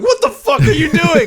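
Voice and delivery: outraged squeal; mock shocked victim. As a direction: shriek it through laughter.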